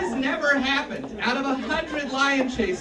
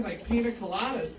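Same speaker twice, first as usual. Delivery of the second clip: ordinary delivery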